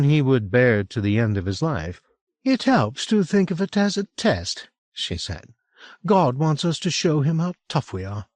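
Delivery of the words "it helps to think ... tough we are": lightly voiced Franny, higher and earnest; a gentle northern woman, steady faith; Franny, earnest friend. A narrator's reading. Franny encourages Will, lightly voiced higher woman.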